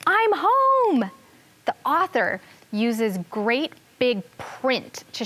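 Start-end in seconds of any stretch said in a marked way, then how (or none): 0.1-1.1 loud, very high elated call; excited shout. Acted. Teacher re-enacts the narrator shouting on the last page, excited shout. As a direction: call it out high and long, thrilled to arrive.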